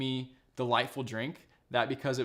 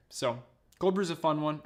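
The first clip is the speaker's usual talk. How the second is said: normal talking voice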